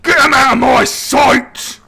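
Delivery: high, loud barked order; angry orc roar. Acted. Warlord ordering the player removed, angry shout. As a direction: throw him out in a rage. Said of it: "And he goes".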